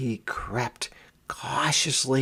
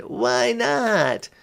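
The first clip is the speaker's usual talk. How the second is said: pleading; 12 semitones higher than usual. A narrator's reading. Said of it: "she begged".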